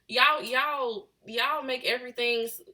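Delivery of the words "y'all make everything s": ordinary talk, nothing marked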